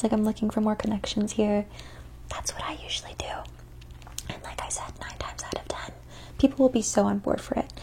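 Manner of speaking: soft ASMR whisper. Acted. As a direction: whisper close to the mic.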